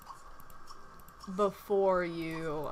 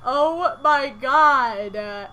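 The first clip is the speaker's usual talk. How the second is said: slow, drawn-out disbelief; long loud oh my god; stunned; 3 semitones higher, 7 dB louder, much slower than usual. Real, not acted. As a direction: stretch it out in shock. Still stunned by mishap.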